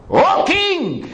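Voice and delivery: high ringing call upward. A narrator's reading. Daniel calls up from den.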